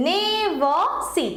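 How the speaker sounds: drawn-out "ne", light "ver" in "never", then "see"; stress on NE. Shown as a demonstration. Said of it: "ভরে কম পড়ছে".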